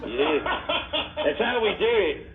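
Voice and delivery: a joking, hammed-up New Zealand accent; host clowning after hearing the beer is a New Zealand IPA, in a bad New Zealand accent. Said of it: "That was a horrible accent".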